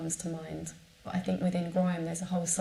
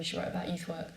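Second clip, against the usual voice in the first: ordinary talk, nothing marked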